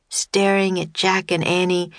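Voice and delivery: plain reading voice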